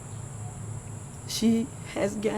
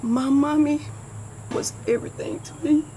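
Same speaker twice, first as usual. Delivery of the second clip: crying; 5 semitones higher than usual. Real, not acted. Grieving daughter's tribute.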